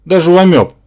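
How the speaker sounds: high, loud, miffed retort; wounded insistence; offended. A narrator's reading. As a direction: push the point indignantly. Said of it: "обиделся Рабан".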